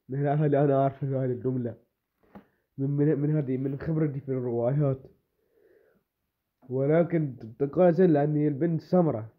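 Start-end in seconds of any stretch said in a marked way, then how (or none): none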